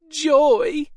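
very high, loud glee; joyful squeal. A narrator's reading. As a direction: squeal with delight. Ogre delighted by praise.

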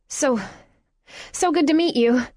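awkward hasty goodbye. Acted. Louisa escaping the awkward meeting, forced cheer. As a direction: fake a bright farewell before fleeing.

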